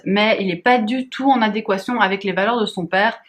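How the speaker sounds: normal talking voice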